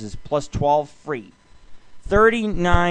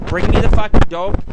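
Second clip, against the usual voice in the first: louder, punched repeat of the line; demanding mock quote; 3 semitones higher, 8 dB louder than usual. Radio host acting out the woman's demand, louder and punchier. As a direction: bark it like she demands it. Quoting a Craigslist ad.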